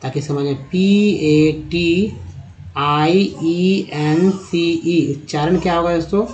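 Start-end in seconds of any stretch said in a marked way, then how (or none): 0.5-5.1 letters spelled out slowly one by one; slow letter-by-letter spelling. Shown as a demonstration. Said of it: "एक word meaning ले ही लेते हैं".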